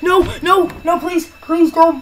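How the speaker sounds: alarmed begging; desperate pleading. Real, not acted. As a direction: plead urgently as it goes wrong. Begging the game not to fail.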